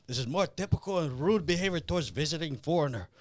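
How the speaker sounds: imitating a stiff Russian villain in a Russian accent; Russian accent, quoting the movie. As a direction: put on Drago's Russian-accented menace. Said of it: "Comrade Big Mouth, uh, starts saying".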